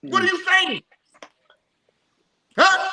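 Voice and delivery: high, worked-up outrage; indignant rant. Real, not acted. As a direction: rant it, rising and incredulous. Indignant rant about God talk.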